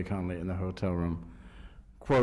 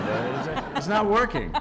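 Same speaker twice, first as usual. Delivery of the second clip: laughing punchline; 5 semitones higher, 5 dB louder than usual. Real, not acted. Joke punchline.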